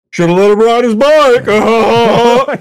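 high, loud, slow put-on voice; mock sneering biker. Friend voicing the biker who broke the tricycle, in a loud, high, slow jeer.

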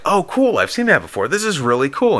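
high, upbeat retold enthusiasm; pleased reaction. Retold reaction to poster gift.

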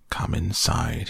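ordinary delivery

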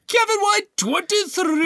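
very high, hammed-up name announcement; mock announcer. Co-host shouting out a reviewer's name, high mock announcer. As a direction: cry the name out in a high put-on voice.

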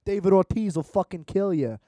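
put-on loud, lost Boston accent; attempted Boston accent, slipping. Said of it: "I can't do a Boston accent. I totally lost it there".

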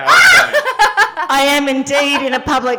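booming echo of a tiled toilet; echoey like God. Real, not acted. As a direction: voice bouncing off toilet walls. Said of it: "You sound like God".

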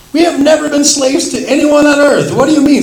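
high, loud indignant protest. Acted. Descendants of Abraham objecting to Jesus, high and loud. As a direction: object hotly like offended leaders.

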